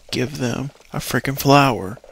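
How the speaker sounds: exasperated burst out of a whisper; a little mad. Real, not acted. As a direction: let annoyance break the hush. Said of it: "I just got a little mad".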